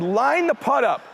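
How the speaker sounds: high, drawn-out repeat of the instruction; retold coaching call. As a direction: insist on it, stretching the words high. Repeats his call to son.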